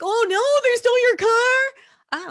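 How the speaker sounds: loud, high, drawn-out cry of outrage; hammed-up panicked yell; mock alarm. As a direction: wail it in exaggerated horror. Mocking police alarm over stolen property.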